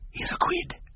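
secretive whisper. A narrator's reading. Vet handing over money in secret, whisper. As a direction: slip it to him under your breath. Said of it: "he whispered".

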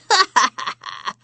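high cackling laugh; witch cackle. Acted. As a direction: cackle like an old hag. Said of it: "Chortled the hag".

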